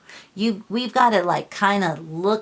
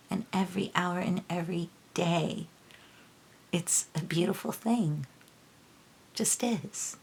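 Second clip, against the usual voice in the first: real choked-up softness, much quieter; getting verklempt; 8 dB quieter than usual. Real, not acted. Reader grateful that loved ones are still alive, verklempt. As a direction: speak softly, moved, with halting pauses. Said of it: "Sorry, I'm getting verklempt".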